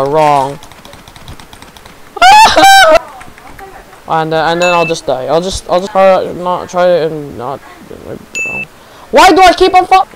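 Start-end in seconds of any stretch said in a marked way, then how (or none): 2.2-3.0 sudden shrieking laugh; high laugh burst. Real, not acted. 9.1-10.1 high exasperated complaint; frustrated yell. Real, not acted. Falls into void again.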